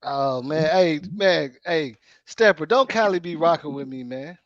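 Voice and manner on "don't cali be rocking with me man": usual speaking voice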